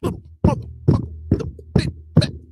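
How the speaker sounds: hissed, high, half-whispered cussing; retold furious hissed cursing at a kid; mock cussing. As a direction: spit the insult under your breath at the kid. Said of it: "Cussing the kid out".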